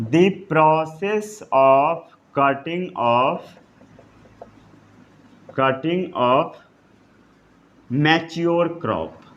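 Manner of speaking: very slow, word by word for note-taking; slow dictation. Shown as a demonstration. Teacher dictating a note to the class, very slow. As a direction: spell it out slowly so students can write. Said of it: "इतना note down कर लिए?".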